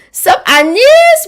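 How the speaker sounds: high, loud, drawn-out hello; excited greeting. Real, not acted. Host greeting a viewer in chat, high and loud. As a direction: call out a big welcome.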